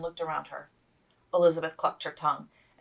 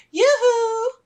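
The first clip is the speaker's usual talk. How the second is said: very high, loud sing-song hail; cheerful lady calling yoo-hoo; 11 dB louder than usual. Acted. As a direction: call out gaily across the camp. Calling out across camp.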